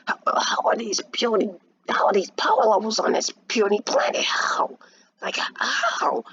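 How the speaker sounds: high, breathy villain voice; villain character voice. Acted. Raditz astonished at the puny planet, villain character voice. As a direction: redo the villain in a strained, whispery high pitch. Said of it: "that was a kinda good voice".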